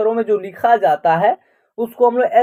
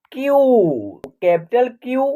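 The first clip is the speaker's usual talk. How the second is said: usual speaking voice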